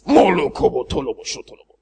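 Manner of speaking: low, soft mock speaking in tongues; low hushed gibberish prayer. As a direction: parody a showy preacher babbling. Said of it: "then you talk like that".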